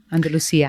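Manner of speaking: "Andalusia" said an-da-loo-SEE-ah; stressed see near the end of "Andalusia"; speaker's own pronunciation. Shown as a demonstration. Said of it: "I always say Andalusia".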